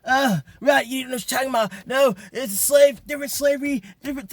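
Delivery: mocking commenters. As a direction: parody the commenters in a squeaky put-on voice.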